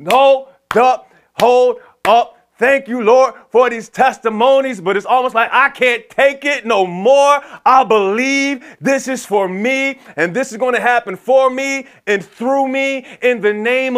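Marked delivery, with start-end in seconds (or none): 0.1-4.8 loud, high, punched-out words; emphatic interruption. Acted. Believer breaking in on others' testimonies to the Lord, emphatic interruption. 5.5-14.0 high, slow, forceful preaching cry; impassioned faith declaration. Acted. Believer claiming the promise for themselves, impassioned declaration.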